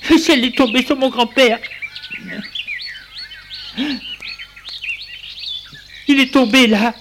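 put-on high, urgent child voice; frightened boy raising the alarm; panicked boy. A narrator's reading. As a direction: blurt it out in a panic. Boy tells servant in panic.

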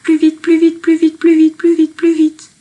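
high, loud, insistent birdsong in words; charbonnière bird call. Acted. Poet mimics the charbonnière singing in the garden, high bird call. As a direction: chirp it loud and high, in rapid repeats. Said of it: "Elle dit".

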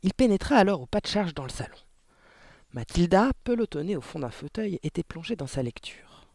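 ordinary delivery

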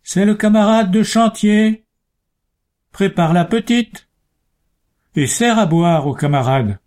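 loud voice. A narrator's reading. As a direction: call out loud so she hears the warning. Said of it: "d'une voix haute".

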